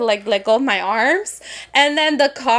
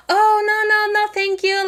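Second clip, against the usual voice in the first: high-pitched, polite brush-off; acted high "no thanks" voice; polite refusal; 9 semitones higher, faster than usual. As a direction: decline politely in a raised, lighter pitch.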